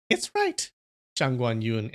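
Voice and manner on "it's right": very high, put-on eagle voice; really high voice. A narrator's reading. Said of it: "I'm gonna give him a really high voice".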